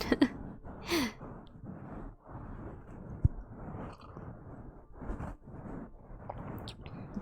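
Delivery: breathy drawn-out laugh; long whispered giggle. Real, not acted.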